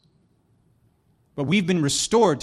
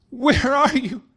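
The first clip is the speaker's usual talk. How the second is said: lightly acted searching call, pitched high; high, plaintive calling voice; 9 semitones higher, 4 dB louder, slower than usual. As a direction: call out for someone who is hiding. God calls out to hiding Adam.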